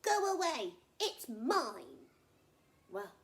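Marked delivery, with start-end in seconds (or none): 0.0-2.1 high, loud angry crocodile yell; shout. Acted. Crocodile shouting at animals at his river. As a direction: bellow to scare them off. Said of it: "he'd shout".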